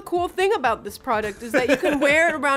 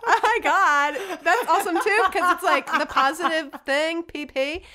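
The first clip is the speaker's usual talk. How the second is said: put-on higher-pitched, giggly Valley Girl chatter; Tiffany; 3 semitones higher than usual. Valley Girl explaining her new nickname, Valley Girl. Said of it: "a character named Tiffany".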